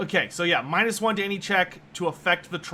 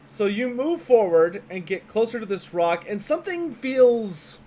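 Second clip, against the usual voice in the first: game master narration; 4 dB louder than usual. Acted. GM sets the scene.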